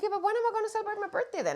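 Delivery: high, quick imitation of a complaining partner; put-on high, hurried complaint; mock pleading partner. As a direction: imitate her protesting in a high voice. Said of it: "and she's like".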